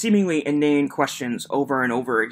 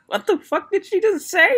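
high, incredulous squeak with a laugh; can't believe what she just heard; stunned disbelief. Real, not acted. Reacting to the Amber Heard clip, high incredulous disbelief.

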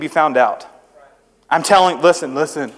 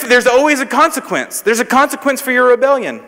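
ordinary talk

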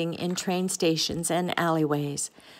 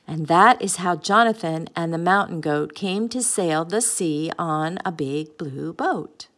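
ordinary delivery, nothing marked

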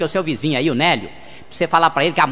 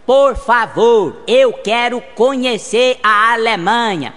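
slow, loud and higher, each word drawn out; speaking very slowly; 6 semitones higher, 5 dB louder, much slower than usual. Acted. Brazilian tourist speaks slowly to a German taxi driver. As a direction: spell it out for a foreigner. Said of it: "você fala devagar".

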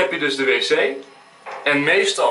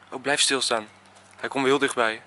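ordinary delivery

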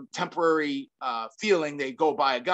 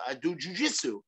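softer, lightly imitated Brazilian accent; George Gurgel; 5 dB quieter than usual. Friend answering about street fighting, imitated Brazilian accent. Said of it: "do my best George, uh, accent".

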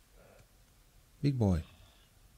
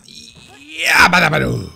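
whooping cheer; 3 semitones higher, 10 dB louder, slower than usual. Real, not acted. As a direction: belt out a cartoon catchphrase. Cheering catchphrase while playing.

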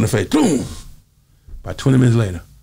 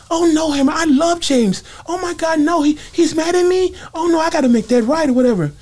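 [name] voice. Retold or imitated. Mike frets to [name] about [name], in a high mock voice. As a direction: imitate Mike's high fretful voice, pitched way up.

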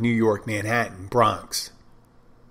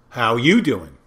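louder imitated Jersey-Philly greeting; Jersey-Philly accent; 6 dB louder, slower than usual. Mimicking people from New Jersey and Philadelphia, Jersey-Philly accent. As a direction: lean lightly into a mock New Jersey accent. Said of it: "New Jersey, Philadelphia region and stuff, and they always go".

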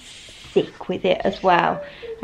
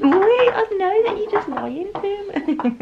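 high, slow teasing; teasing accusation; 12 semitones higher, slower than usual. Real, not acted. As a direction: tease him for fibbing. Teasing husband's fake answer.

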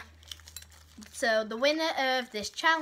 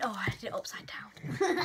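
whispered; 3 semitones lower, 4 dB quieter than usual. Real, not acted. Blindfolded, fumbling with the popsicle.

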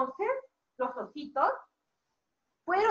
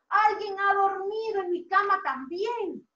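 higher, indignant storybook voice; Mama Bear; 4 semitones higher than usual. Acted. Teacher voices mamá osa finding her bed slept in, indignant. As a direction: complain in mock dismay. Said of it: "Mamá osa dijo".

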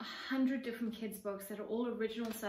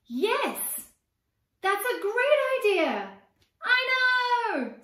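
high, loud delighted cry; joyful shout; 11 semitones higher, 11 dB louder, much slower than usual. Acted. Brother and sister shouting at the new idea, overjoyed. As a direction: burst out with the big idea.

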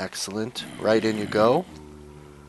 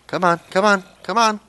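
high, loud frantic coaxing; much higher, louder calls; urgent calling; 10 semitones higher, 10 dB louder than usual. Real, not acted. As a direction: call the zombie over, getting desperate.

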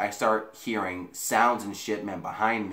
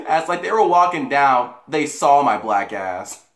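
laughing; 8 semitones higher, 8 dB louder than usual. Real, not acted. Laughing climax of story.

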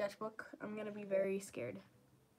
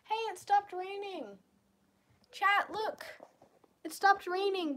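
high and loud with joy; excited; 8 semitones higher, 8 dB louder than usual. Real, not acted. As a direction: call out the good news. Excited the rain stopped.

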